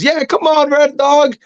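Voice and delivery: high-pitched imitation of a young fan; little kid cheering. Little kid in the crowd backing a wrestler, imitated high cheer. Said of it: "and one little kid goes".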